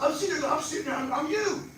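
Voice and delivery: ordinary talk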